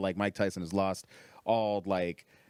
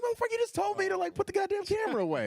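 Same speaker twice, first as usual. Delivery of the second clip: very high-pitched comic outrage; indignant protest; faster than usual. Retold or imitated. Camera man answers comic asking for the footage, indignant. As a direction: squeal it in mock disbelief. Acted quarrel over the camera.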